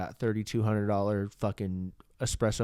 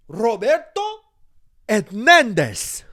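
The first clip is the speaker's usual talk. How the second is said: yelled much higher and louder in a rolling Spanish accent, Hernandez stretched slow; 12 semitones higher, 10 dB louder, slower than usual. Shown as a demonstration. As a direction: belt out Roberto Hernandez like a mariachi. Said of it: "I say it like that, really loud".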